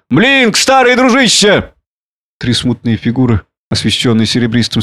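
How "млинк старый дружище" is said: put-on high, drawn-out drunken hail; calling out. A narrator's reading. Drunken man summons his old friend, calling out. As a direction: hail an old pal across the yard. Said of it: "крикнул он".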